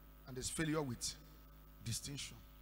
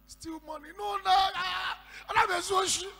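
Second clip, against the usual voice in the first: higher, shouted protest of innocence; indignant denial; 13 semitones higher, 13 dB louder than usual. Retold or imitated. Woman protests to the pastor in loud disbelief. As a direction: fling back the accusation in shock.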